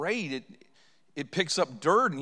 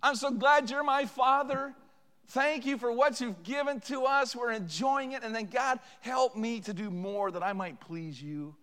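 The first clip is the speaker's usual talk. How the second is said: slow, higher, heartfelt thanks to God; loving prayer; 7 semitones higher, slower than usual. Shown as a demonstration. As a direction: pray it slowly and tenderly.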